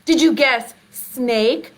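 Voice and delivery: normal talking voice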